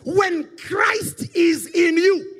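loud, slow fiery preaching. Acted. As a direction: shout it from the pulpit. Preaches at full force.

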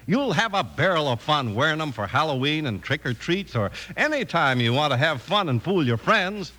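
ordinary talk

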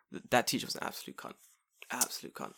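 muttered insult. Real, not acted. Bitter aside about the teacher.

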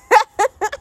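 high amused giggle; real laughter. Real, not acted. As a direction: burst out giggling at yourself. Laughs after the exclamation.

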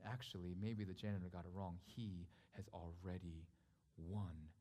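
low, soft, weighty delivery; solemn preaching. Acted. Preacher reveals the story's meaning to church, solemn. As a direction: let each statement settle quietly.

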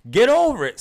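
exasperated shout. Real, not acted. Podcast host ranting at circle cereals, shouting. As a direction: yell it at the cereal like it offended you.

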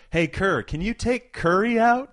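high, loud courtside heckle; imitated heckler's yell. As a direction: yell it like a fan across the arena. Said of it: "he yelled".

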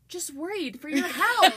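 laughing mock concern. Real, not acted. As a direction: giggle through the worry.

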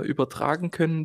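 ordinary talk, nothing marked

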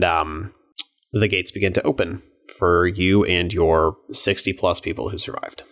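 ordinary talk

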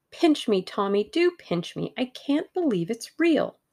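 high, eager and lively; giddy excitement. A narrator's reading. Tuppence begging Tommy to pinch her, giddy. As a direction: bubble over in happy disbelief. Overjoyed at new job.

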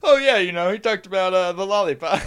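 high, loud fake-confident answer; mock casual bluff. Caught bluffing to his friend, breezy fake-confident reply. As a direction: breezily pretend he listened. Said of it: "And I was like".

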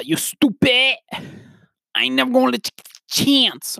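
high-pitched mock rapper yelling; six nine. Imitating a wild rapper's song, six nine impression. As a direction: squeal it like a hammed-up rap taunt. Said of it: "from a New York accent to six nine".

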